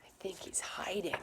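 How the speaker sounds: soft unvoiced whisper; a playful secret whisper; mother whispering about Peter.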